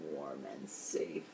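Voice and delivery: soft slow whisper; reading bedtime story, whispering the cozy end. A narrator's reading. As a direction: whisper it like a lullaby.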